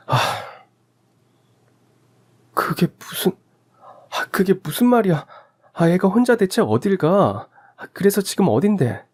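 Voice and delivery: a mother's rising panic; panicked mother. Acted. Wife panics on phone. (아이 잃은 소식에 당황한 엄마)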